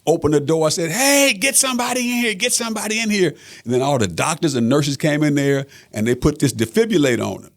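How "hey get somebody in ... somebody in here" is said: much higher, urgent yell for staff; retold scream for help. Father in the ER yelling for doctors, urgent shout. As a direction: shout through the open door for help. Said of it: "I just started screaming".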